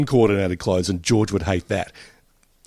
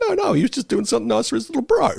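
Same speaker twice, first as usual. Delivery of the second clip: fast defending; 12 semitones higher, faster than usual. Retold or imitated. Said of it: "It's like".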